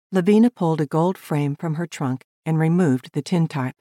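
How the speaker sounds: plain reading voice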